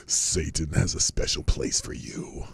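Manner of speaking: low breathy whispered menace; creepy Satanist whisper. Retold or imitated. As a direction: hiss it like a sinister devotee. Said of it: "that last line by the Satanist".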